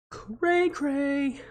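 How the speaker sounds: high, soft and silly; playful cray cray. Pretend. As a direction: toss it off up high as a joke. Playful slang aside while reading.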